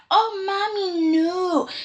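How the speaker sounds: high, soft, whiny imitation of a daughter; mock-tearful little plea; mock pleading daughter. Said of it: "how can you be like".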